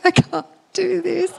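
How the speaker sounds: high, tearful wail, played up; hammed-up helpless cry; mock whimper. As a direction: whine it as if near tears. Panicking, refusing son's instructions.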